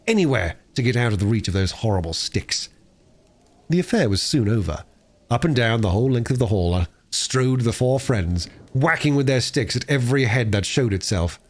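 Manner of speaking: ordinary delivery, nothing marked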